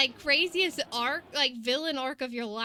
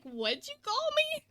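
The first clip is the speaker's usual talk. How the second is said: tough guy retort; 12 semitones higher than usual. Retold or imitated. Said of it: "Guy's like".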